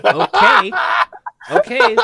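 high, loud granting voice; genie. Acted. Genie answering the wish.

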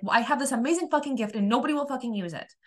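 high-pitched exasperated complaint; retold resentment. Resentful towards everyone ignoring the gift, exasperated. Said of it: "I was resentful".

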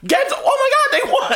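squealing mock announcer, laughing; excited commentator. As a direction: play the commentator losing it as time runs out. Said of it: "three, two, one".

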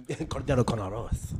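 echoing the name in a Castilian Spanish accent. Shown as a demonstration. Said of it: "Yeah, I do".

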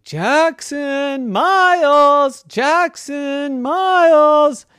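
high, long drawn-out call; calling out names. Acted. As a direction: holler for missing children in singsong. Parents call missing kids.

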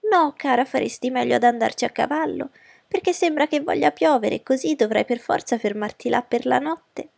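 high, insistent mother's voice; scheming fussy mother. Acted. Mother urging her daughter to ride, high and insistent. Voices Mrs. Bennet in the story.

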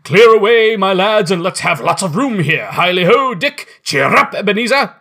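rally the lads to clear the floor; jovial shout. Acted. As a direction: Fezziwig shouting to clear the warehouse, loud and high. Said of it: "cried old Fezziwig".

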